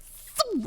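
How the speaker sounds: sudden high squeaky thump sound; imitated thump. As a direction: spit it out like an impact noise. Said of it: "it was like".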